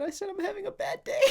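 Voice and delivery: very high, cracking with laughter; laughing through quote. Retold or imitated. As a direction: try to say it but the laugh takes over. Quoting customer while laughing.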